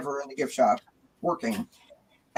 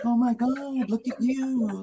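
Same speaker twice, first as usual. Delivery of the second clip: gushing greeting; 7 semitones higher, slower than usual. Retold or imitated. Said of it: "So I go up and I'm like".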